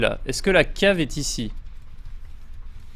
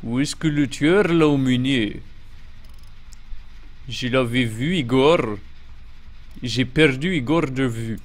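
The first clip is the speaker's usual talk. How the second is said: mock Russian-accented French; French with a put-on Russian accent; 3 semitones lower, slower than usual. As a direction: play a puzzled Russian, speaking French. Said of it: "Je peux tenter d'utiliser l'accent russe".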